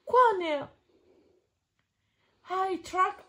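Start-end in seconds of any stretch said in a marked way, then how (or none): none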